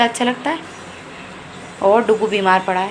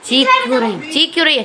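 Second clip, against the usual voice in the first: calling out; 6 semitones higher, 4 dB louder than usual. Real, not acted. Mother asking the screaming girl why she shrieks, loud and high. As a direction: call out sharply across the room.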